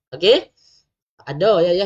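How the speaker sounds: usual speaking voice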